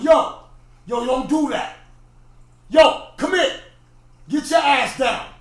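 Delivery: loud, high barked orders; acted full-volume telling-off; yelling at kids. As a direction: shout across the playground at misbehaving kids. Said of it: "he was yelling at me".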